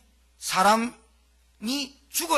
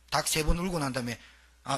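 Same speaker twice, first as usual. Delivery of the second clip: ordinary delivery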